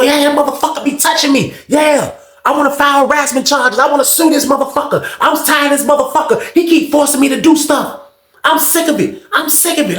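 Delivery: high-pitched aggrieved rant; outraged complaint in a high voice; snapping out. Retold or imitated. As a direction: imitate someone snapping at an officer. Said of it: "He just snapping out on the C O".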